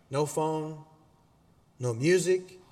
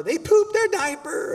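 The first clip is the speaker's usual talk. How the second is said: very high, drawn-out kid impression; loud, squeaky mock child whine; tattling kid; 8 dB louder than usual. Preacher imitates his kid reporting a diaper, high-pitched tattling.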